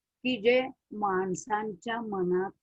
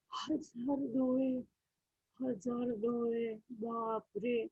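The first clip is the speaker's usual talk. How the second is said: hushed awe; 10 dB quieter, slower than usual. A narrator's reading. Narrator marvels in hushed awe.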